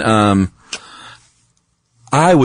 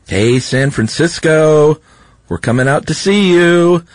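calling out; 6 semitones higher, slower than usual. Acted. Calling out to city.

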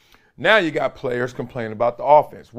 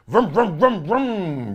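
engine noise; 5 semitones higher, much slower than usual. Retold or imitated.

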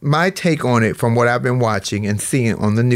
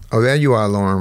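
ordinary talk

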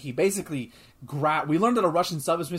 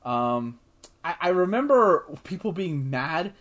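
ordinary talk

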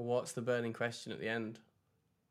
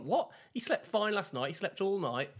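higher, louder mock disbelief from mum; incredulous mum; 9 semitones higher, 5 dB louder than usual. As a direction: play mum scoffing at dad's complaint. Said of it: "And she's like".

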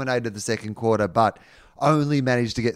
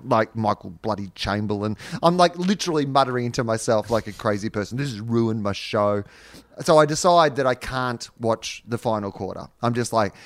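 usual speaking voice

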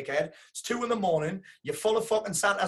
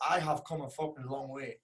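quiet awe; 3 semitones lower, 7 dB quieter than usual. Real, not acted. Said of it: "holy shit".

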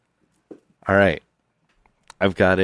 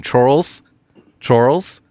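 calling his son, a bit louder; raised call across the station; 4 semitones higher, 3 dB louder than usual. Acted.

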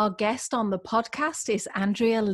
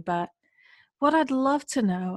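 ordinary talk

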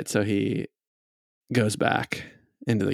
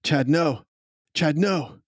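a waiter calling out his name in alarm, higher; 6 semitones higher than usual. Acted. Waiter warning Chad not to drink the poison, calling out. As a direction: call out to stop him drinking. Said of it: "the waiter calls out and says".

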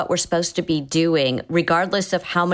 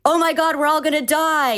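mock panic; 9 semitones higher than usual. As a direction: play a pilot losing it in a half-serious way. Pilot who doesn't stay calm.

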